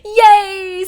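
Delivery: high, loud giggle then cheer; laughing cheer. Real, not acted. Her luck finally turns.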